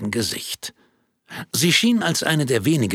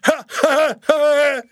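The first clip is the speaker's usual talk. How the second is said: loud high laugh; 6 dB louder than usual. Acted. Audience laughter.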